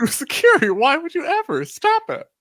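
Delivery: high squeaky mock panic; squealing mock fear. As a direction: squeal it like a scared kid.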